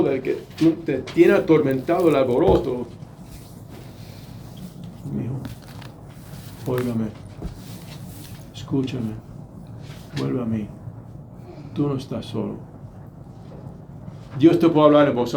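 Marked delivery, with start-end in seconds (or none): 5.1-12.6 softer, gentle call to a troubled son; tender plea. Shown as a demonstration. God calling the discouraged son back, tenderly. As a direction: coax him home gently. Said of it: "en tu desánimo".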